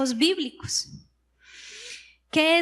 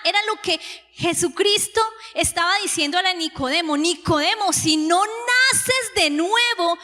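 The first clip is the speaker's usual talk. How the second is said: raised, insistent preacher's voice; impassioned preaching; 4 semitones higher than usual. Real, not acted. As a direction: drive it home to the congregation, pitched up and emphatic. Preaches on dying to self.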